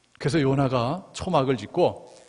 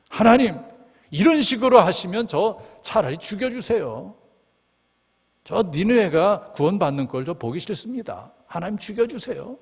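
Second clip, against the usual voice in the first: high, pleading, indignant cry to God; angry complaint; 8 semitones higher than usual. Retold or imitated. Preacher voicing Jonah, high and angry. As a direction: Jonah protests, begging to die. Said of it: "막 화를 내는 거예요".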